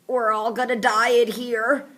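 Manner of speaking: put-on slow, nasal kid's whimper; stuffy-nosed scared kid, drawn out. A narrator's reading. Mouth breather in crate, nasal whine. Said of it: "says a nasal voice".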